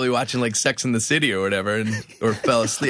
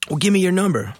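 pushy stranger; 9 semitones higher, 4 dB louder than usual. Retold or imitated. Stranger pressing for number.

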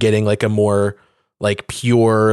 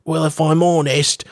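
Tom Holland's little voice; 4 semitones higher than usual. Retold or imitated. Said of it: "in his little voice that he does".

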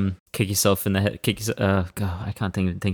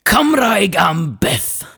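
high, slow, drawn-out shout; rousing, full-throated Welsh slogan; patriotic yell; 10 semitones higher, much slower than usual. Pretend. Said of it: "yell it for me again in your most patriotic manner".

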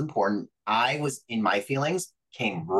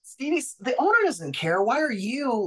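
heated retort; 12 semitones higher than usual. Retold or imitated. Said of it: "I was like".

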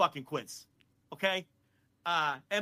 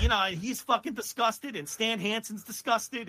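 ordinary talk, nothing marked

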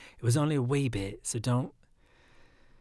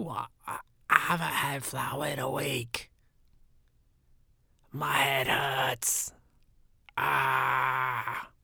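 a pretend pained outburst, louder, slow at the end; pained complaint; 2 semitones higher, 4 dB louder, much slower than usual. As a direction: moan it out as if your head is splitting. Unsaid complaint to wife.

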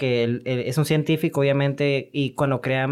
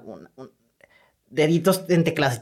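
ordinary delivery

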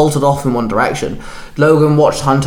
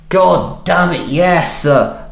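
higher, slow strained bark; frustrated raised shout; 4 semitones higher, much slower than usual. Acted. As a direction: give in through pain, annoyed. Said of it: "J J barked".

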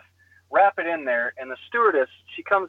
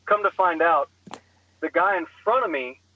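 ordinary talk, nothing marked

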